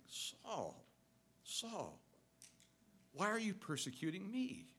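soft, grieving call of the name; broken-hearted voice. Retold or imitated. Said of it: "is a voice of a broken heart".

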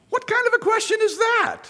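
very high-pitched disbelief; incredulous. Retold or imitated. Preacher reacting to Peter's request, comically incredulous. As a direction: pitch it way up, baffled by the question.

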